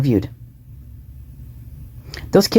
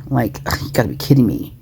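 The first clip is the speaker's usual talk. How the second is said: low, soft, sneering; mock disgust; 5 semitones lower, 5 dB quieter than usual. Shown as a demonstration. Vlogger acting out the woman's sneer, low and disgusted. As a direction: let a lightly faked groan of disbelief out. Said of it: "Something like that".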